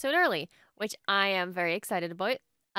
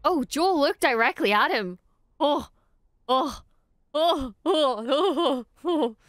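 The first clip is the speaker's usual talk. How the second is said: higher, louder breathless ohs; giddy excitement; 5 semitones higher, 4 dB louder, slower than usual. Real, not acted. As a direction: fan out over a glance. Giddy reaction to Joel's gaze.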